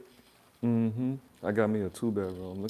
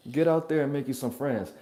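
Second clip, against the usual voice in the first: usual speaking voice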